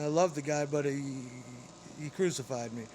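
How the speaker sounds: ordinary talk, nothing marked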